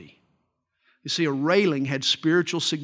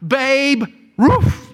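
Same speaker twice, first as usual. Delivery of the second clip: talking dog voice; 7 semitones higher, 7 dB louder than usual. Acted. Talking dog answers the question.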